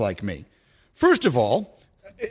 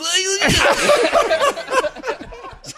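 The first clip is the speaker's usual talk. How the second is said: laugh; 3 dB louder than usual. Real, not acted. Host laughs at imitation.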